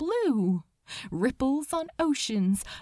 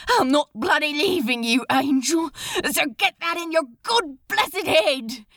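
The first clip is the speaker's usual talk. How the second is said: crying out; 3 semitones higher than usual. Acted. Demon refusing to leave the angel, fierce cry. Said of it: "Crowley cried".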